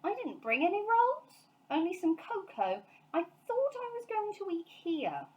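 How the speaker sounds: lightly voiced little bear; innocent bear. A narrator's reading. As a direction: explain innocently. Paddington voiced.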